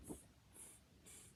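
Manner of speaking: tsk tsk. Acted. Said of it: "shook their hands back at him and said".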